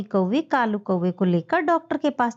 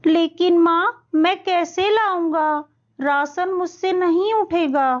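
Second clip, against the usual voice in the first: put-on high, pleading child voice; a small boy begging his mother; little boy voice; 5 semitones higher than usual. Young son pleading.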